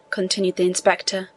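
ordinary delivery, nothing marked

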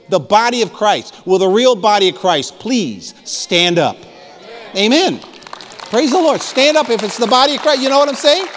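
rising to a high, loud preaching climax; full-force preaching. Acted. Preacher calling the church to stand up, fervent and loud. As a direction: lift the congregation to its feet, shouting glory.

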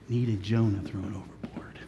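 low whispered point. Real, not acted. Preacher whispers the lesson to listeners. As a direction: breathe the point out.

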